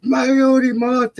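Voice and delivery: put-on high-pitched panicked child voice; screaming little kid. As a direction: imitate a frightened little brother shrieking. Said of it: "screaming their fucking heads off".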